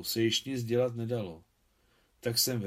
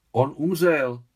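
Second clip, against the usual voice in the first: louder, higher cry of disbelief; shocked cry; 5 semitones higher, 10 dB louder, slower than usual. Acted. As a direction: cry out at sudden bad news. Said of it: "vykřikla Gulia".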